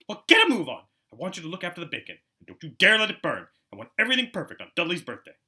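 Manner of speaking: high, loud nagging orders; bossy aunt. Acted. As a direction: snap commands at the boy. Aunt orders Harry about.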